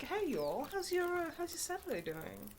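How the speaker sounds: a villain playing cheery vlogger; mock Sephiroth vlogger. Voicing Sephiroth greeting his followers, mock cheer. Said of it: "That's what Seph".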